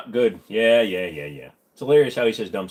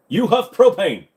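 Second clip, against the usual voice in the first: loud, high, slow cartoon dad impression; King of the Hill; 8 semitones higher, 7 dB louder, slower than usual. Shown as a demonstration. Cartoon dad accusing someone, loud put-on voice. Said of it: "I don't do a good King of the Hill".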